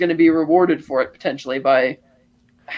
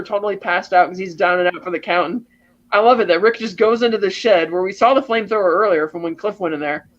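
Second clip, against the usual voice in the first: usual speaking voice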